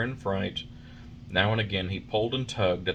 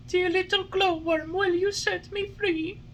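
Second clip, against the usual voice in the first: put-on high puppet boy voice, pleading; a small wooden boy begging to be freed; Pinocchio. A narrator's reading.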